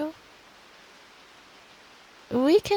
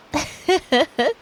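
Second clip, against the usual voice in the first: giggle; 5 semitones higher, 4 dB louder than usual. Real, not acted. Laughs at her own story.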